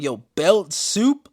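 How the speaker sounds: slow and loud, stretched-out disbelief; incredulous belt soup. Retold or imitated. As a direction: drag out the question as if it's absurd. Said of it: "And I'm like".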